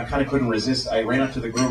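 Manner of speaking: ordinary talk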